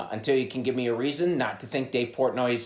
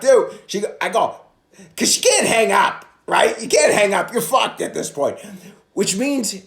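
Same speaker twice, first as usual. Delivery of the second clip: ordinary delivery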